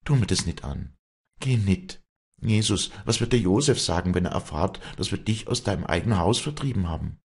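high-pitched, urgent entreaty; pleading. A narrator's reading. Afra begging Wally not to go, pleading. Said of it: "bat Afra".

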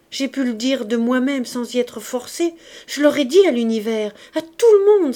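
building to a high, loud cry; anguished outburst. A narrator's reading. Jean Valjean pleading with Marius for silence, anguished outburst. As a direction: let the distress swell into a shout.

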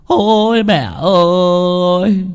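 high, slow, drawn-out mourning wail; keen over the coffin like a grieving son; wailing lament. Acted. Son wailing beside his mother's coffin, slow high lament. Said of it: "mếu máo".

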